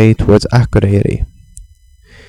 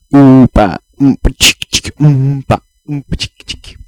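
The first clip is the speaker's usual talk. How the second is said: oompa beat; 3 semitones higher than usual. Pretend. Beatboxing the hip-hop beat.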